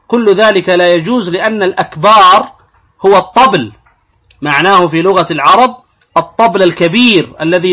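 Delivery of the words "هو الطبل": ordinary delivery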